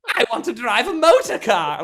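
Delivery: high, loud hammed-up toad; Mister Toad voice. Actor showing his Mister Toad role, high and loud. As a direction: bellow it like a pompous Toad. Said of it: "playing Mister Toad".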